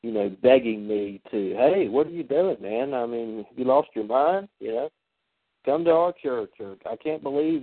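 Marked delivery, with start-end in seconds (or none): none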